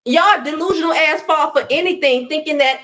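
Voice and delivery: ordinary delivery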